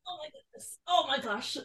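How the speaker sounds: high, soft cooing; cooing at dog. Real, not acted. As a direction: coo at the pug. Pug appears, cooing.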